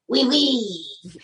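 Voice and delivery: lightly French, eager; a hint of a French charmer; eager French oui. Puppet agrees eagerly.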